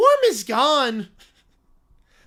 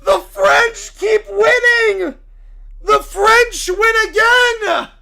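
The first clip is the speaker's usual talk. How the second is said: loud, anguished preacher's cry; a wounded zealot shouting at the sky; outraged preacher; 10 semitones higher, 10 dB louder, slower than usual. Acted. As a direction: howl it like your church just fell. Character despair.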